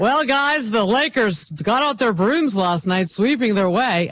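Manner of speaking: hammy sportscaster with a punning lead-in; local news sportscast shtick. Acted. Mocking local news coverage of a sweep, sportscaster shtick. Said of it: "all the shtick that goes with".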